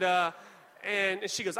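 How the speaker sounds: ordinary talk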